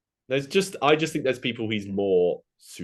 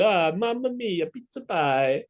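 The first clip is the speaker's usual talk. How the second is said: high, slow jolly Italian pizza chef; Italian accent; 12 semitones higher, slower than usual. Acted. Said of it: "He would sound like".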